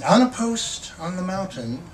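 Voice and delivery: measured, expressive poetry reading; poem reading. Acted. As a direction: read the poem like you're savouring each image. Poet reads the poem Mockingbird.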